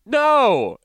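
high loud burst; sudden loud laughing no; emphatic no. Real, not acted. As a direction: blurt the denial. Emphatic denial, then laughing.

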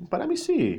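high outburst. A narrator's reading. Mr A's outburst.

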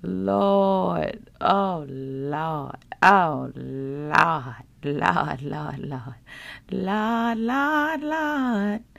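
exasperated drawn-out Lord, Lord; mock complaining groan. Host mocking people who complain to God, exasperated. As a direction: whine to God like a fed-up mom. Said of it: "those times where you were like".

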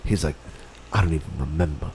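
gruff brush-off. Retold or imitated. Imitating the friend dismissing thanks in a low gruff voice. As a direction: drop the voice low and shrug it off.

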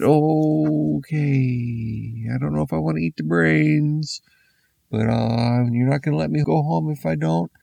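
high, timid man asking to leave; nervous man voice. Acted. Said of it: "So the man said".

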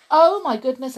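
loud, high gasp of shock. A narrator's reading. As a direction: exclaim in alarm. Narrator exclaims.

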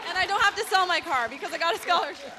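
loud, high burst of excitement; real high, jubilant announcement; elated exclamation. Real, not acted. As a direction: shout the good news over the cheers. Winner exclaims her good news to cheers.

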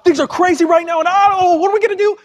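high, fast, loud panicked babble; hammed-up frantic panic, pitch climbing; mock freaking out. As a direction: play someone losing it completely. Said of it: "everybody else is freaking out and they're going".

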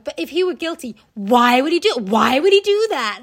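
mock podcasters. Mocking podcasters defending a killer, loud incredulous whine. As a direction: ham up their naive disbelief. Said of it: "they're like".

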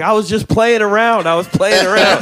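high, loud pleading protest; mock pleading woman. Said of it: "she was like".

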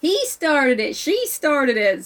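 high, drawn-out playground blame; mock two kids telling on each other; squabbling kids. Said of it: "And the kids are like".

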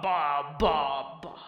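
fading echo, down to a whisper. Pretend. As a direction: let the name echo away.

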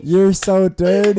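drawn-out, loud mock outrage; teasing accusation. Real, not acted. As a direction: stretch it out, scolding a friend. Mock accusing friend over the story.

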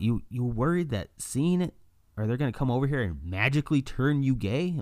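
mock disbelief at their fear; mocking retort. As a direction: scoff at the idea loudly. Mocking homophobes.

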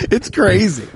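ordinary talk

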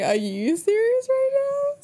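high, drawn-out mock-incredulous squeal; retold comeback. Host firing the question back at co-host, squealed mock disbelief. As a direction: throw the question back, slow and soaring in pitch. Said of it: "And I was like".

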